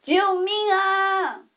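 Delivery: slow, high, loud cry for help. A narrator's reading. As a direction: belt the cry out, drawn long. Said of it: "青蛙们喊叫的声音更大". (更大声地拖长呼救)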